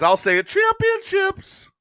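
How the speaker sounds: high, loud comment readout; mock proclamation. Reading a viewer's comment out to the audience, high mock proclamation. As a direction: stretch the last word out high and loud.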